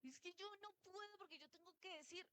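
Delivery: whiny excuse; mock whining excuse. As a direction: imitate someone making excuses. Mocking a talkative excuse.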